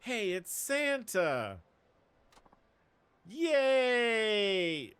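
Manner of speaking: put-on high, slow kid squealing with joy; excited child. Streamer voicing kids spotting Santa, gleeful squeal. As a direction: squeal like a thrilled little kid.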